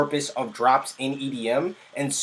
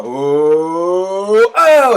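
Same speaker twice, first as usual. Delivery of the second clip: rising "oh" swooping up into a loud high burst; imitated build-up; 3 semitones higher, 7 dB louder than usual. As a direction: slide the "oh" upward, then pop it loud. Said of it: "you hear a".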